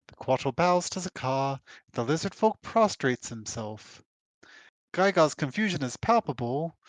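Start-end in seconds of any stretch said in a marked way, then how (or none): none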